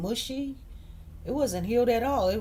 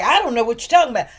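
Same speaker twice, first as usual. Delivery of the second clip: dismissive retort; 4 semitones higher, 11 dB louder, much faster than usual. Retold or imitated. Colleague's dismissive retort retold.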